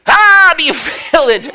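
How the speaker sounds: slow, building to a loud cry of be filled; preacher's blessing. Retold or imitated. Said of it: "she lays her hands on me and she starts".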